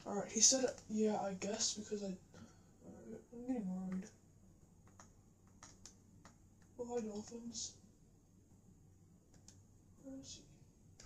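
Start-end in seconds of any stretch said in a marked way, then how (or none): 1.7-11.0 soft worried whisper. Real, not acted. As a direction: hush it, slow and uneasy. Worried, searching underwater.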